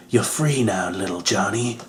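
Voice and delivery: tender hero. Retold or imitated. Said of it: "he's all like".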